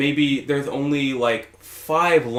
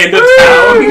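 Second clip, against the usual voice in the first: laughing shriek; 12 dB louder, slower than usual. Real, not acted. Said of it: "laughing shout".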